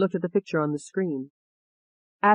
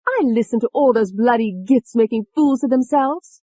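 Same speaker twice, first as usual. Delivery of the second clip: high, loud scornful rant; worked-up, high-pitched disdain; angry contempt; 7 semitones higher, 5 dB louder than usual. A narrator's reading. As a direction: sneer at the fools in a raised voice. Angry alter rants about the witnesses.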